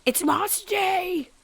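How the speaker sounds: high, slow and drawn-out announcement; excited. Real, not acted. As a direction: stretch it out, thrilled. Excited about game's moss day.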